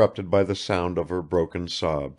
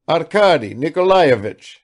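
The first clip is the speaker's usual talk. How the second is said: ordinary delivery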